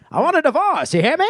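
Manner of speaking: high, dramatic transatlantic diva; glamorous old hollywood star. Pretend. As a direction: deliver it like a 1930s film star. Old Hollywood movie line.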